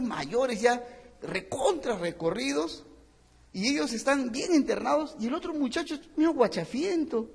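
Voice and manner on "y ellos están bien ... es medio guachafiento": ordinary talk, nothing marked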